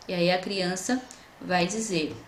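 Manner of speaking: ordinary delivery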